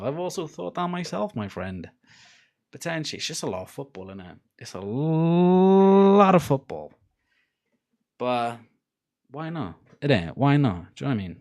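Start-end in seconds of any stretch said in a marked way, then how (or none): 4.6-6.9 LOT held very long and louder; "lot" hugely drawn out for emphasis; stretched emphasis. Real, not acted. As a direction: drag out the start of "lot" in a swelling glide. Repeats line with huge stretched word.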